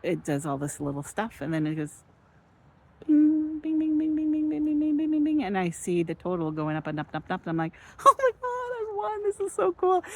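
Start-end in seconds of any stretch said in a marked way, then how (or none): 3.1-5.4 high, bright ringing bings like a jackpot machine; ding it out high like a winning slot. Retold or imitated. Recounting her jackpot to viewers, high imitated machine bings. 8.0-10.0 much higher, louder squeal of disbelief; squealing with excitement. Retold or imitated. Retelling her casino win to viewers, high excited squeal. Reliving winning at slot machine.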